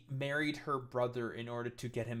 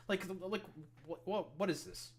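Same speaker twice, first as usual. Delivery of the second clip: stammered, higher-pitched disbelief; bewildered; 7 semitones higher than usual. Real, not acted. Commentator reacting to a rambling post, bewildered. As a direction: stumble over the question in bafflement.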